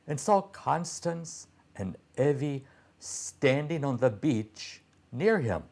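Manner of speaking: usual speaking voice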